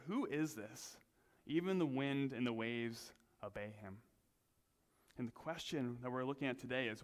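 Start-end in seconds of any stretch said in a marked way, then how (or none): none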